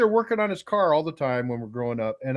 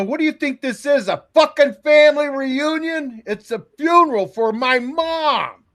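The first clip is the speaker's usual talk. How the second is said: high, loud indignant outburst; acted-out furious rebuke; retold outrage; 10 semitones higher, 7 dB louder than usual. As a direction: lay into someone in disbelief. Acts out outrage at dad.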